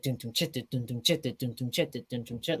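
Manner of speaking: quick, soft vocal drum pattern; fast beat. Shown as a demonstration. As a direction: rattle off the dun-dun-chit beat quickly and quietly. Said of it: "it has, like, a fast part".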